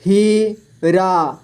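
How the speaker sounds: हीरा split slowly into ही, रा; syllable by syllable. Shown as a demonstration. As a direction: stretch and separate ही and रा in Heera. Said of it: "इस तरह से आपको". (हीरा को तोड़कर धीरे: ही, रा)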